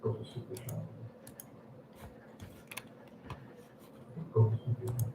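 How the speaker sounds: softly whispered, no voice; whispered aside to the mic. Shown as a demonstration. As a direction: breathe the words without voice. Repeats chat line whispered.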